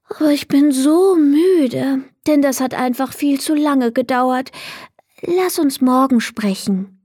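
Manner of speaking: put-on a sleepy girl begging off until tomorrow; tired girl. Leni's voice, tired. (müdes Mädchen, höhere Stimme)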